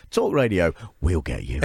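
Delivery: low threat on "we'll get you"; menacing. Pretend. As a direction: make it sound like a threat. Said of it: "So they just say".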